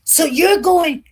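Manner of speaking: high, slow, accusing start; angry retort. Retold or imitated. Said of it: "he got angry with me too".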